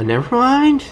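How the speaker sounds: high squeaky never mind. Real, not acted. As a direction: squeak it out in a silly voice. Repeats in a squeaky voice.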